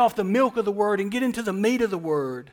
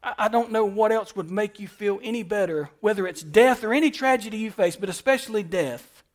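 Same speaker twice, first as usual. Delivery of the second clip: ordinary delivery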